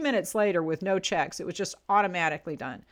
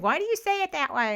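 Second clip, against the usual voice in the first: high-pitched imitation of questioning kids; imitating her kids; 9 semitones higher than usual. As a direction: mimic children asking, pitched well up. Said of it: "my kids are always saying".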